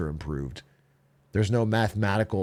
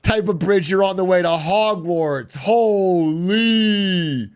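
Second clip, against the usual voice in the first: awed excitement; 5 semitones higher, 4 dB louder, slower than usual. Real, not acted. Awed by bridge on screen.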